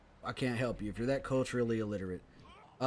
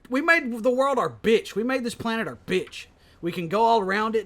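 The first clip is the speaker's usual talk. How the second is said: triumphant rant; 8 semitones higher, 8 dB louder than usual. Real, not acted. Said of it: "boastful rant peak".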